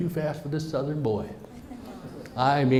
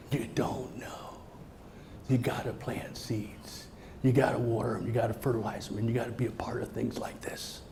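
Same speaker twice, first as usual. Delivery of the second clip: urgent whisper; 4 semitones lower, 8 dB quieter than usual. Real, not acted. Speaker to audience, urging them to plant seeds in a whisper. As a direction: lean in and whisper the call to act.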